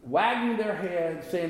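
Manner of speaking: ordinary talk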